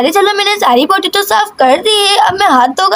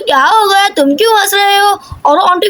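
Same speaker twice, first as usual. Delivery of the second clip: usual speaking voice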